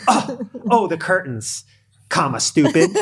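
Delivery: mock contemptuous quote, starting high, ending loud; imagined insult. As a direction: play up an accusing jab that was never meant. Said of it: "She didn't say anything like that".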